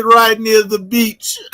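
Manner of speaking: put-on high, drawn-out comic Jamaican accent; Dave Chappelle Jamaican bit. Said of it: "he did the whole Dave Chappelle".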